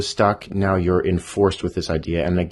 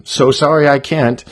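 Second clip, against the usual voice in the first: hasty loud apology; 8 semitones higher, 9 dB louder than usual. Acted. Badger flees.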